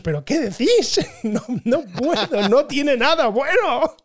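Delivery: exasperated, laughing through it; laughing mock outrage. Telling the hosts through the phone, laughing disbelief. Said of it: "quería entrar dentro del teléfono y deciros".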